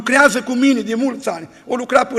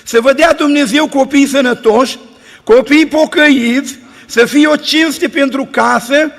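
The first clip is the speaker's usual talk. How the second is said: preaching at full force; 4 dB louder than usual. Acted. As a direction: build the closing blessing to the congregation. Closing exhortation of sermon.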